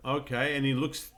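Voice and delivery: ordinary delivery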